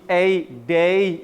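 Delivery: ए, डे stretched very slowly to show the ay glide; teacher stretching the vowel in day; drawn-out ay. Shown as a demonstration. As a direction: pull out the ए in डे slowly. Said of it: "इसका जो ये पूंछ है ना".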